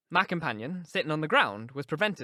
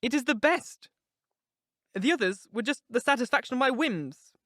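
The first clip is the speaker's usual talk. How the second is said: put-on higher, urgent young woman confiding; Catherine, passionate; 8 semitones higher, 5 dB louder than usual. A narrator's reading. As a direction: pour out her heart to Nelly, raised voice. Catherine voiced in reading.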